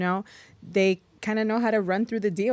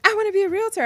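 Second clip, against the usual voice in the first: much higher, mock-eager wannabe voice; mock eager newbie; 13 semitones higher, 5 dB louder, faster than usual. Mocking new agents who rushed back into the market, mock eager. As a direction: parrot the eager newcomers, pitched way up.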